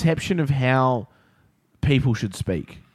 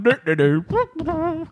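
high, slow do-do-do; playful mock humming as he strolls off; hummed jaunty tune; much slower than usual. Camp counsellor going on his way with the rabbit, jaunty humming. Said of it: "he's on his way going".

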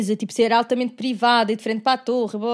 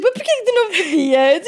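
teasing question; 6 semitones higher, 6 dB louder than usual. Retold or imitated. Retold teasing question to friend.

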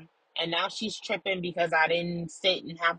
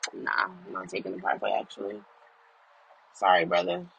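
much lower and fast, brushing it off; deep dismissive voice; 10 semitones lower, faster than usual. Pretend. Answering his own brain, deep fast dismissive voice. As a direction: drop into a deep lazy voice and refuse.

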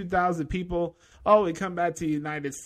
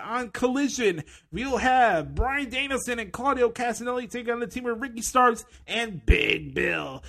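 mock announcer; 4 semitones higher than usual. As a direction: sell the card like a cheesy TV announcer. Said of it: "let's do the excrement impression".

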